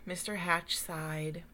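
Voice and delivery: ordinary delivery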